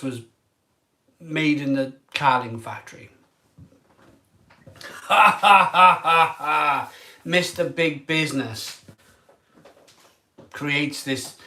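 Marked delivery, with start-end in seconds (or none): 4.8-6.9 loud bitter laugh at his own joke; short loud rueful laugh. Real, not acted. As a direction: burst out laughing, half rueful.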